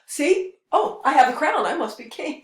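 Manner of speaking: a fake king parading his stolen crown; mock crown thief. Crown thief boasting to the court, smug mock pride. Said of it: "someone stealing the, the king's crown".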